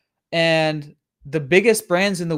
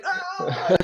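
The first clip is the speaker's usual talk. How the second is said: high mock yell through laughter; laughing mock yell. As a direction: yelp it while laughing.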